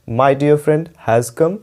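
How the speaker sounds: slow, clearly spaced words; slow, careful teacher read; slow dictation. Shown as a demonstration. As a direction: read it out slowly for students to copy. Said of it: "पहला है".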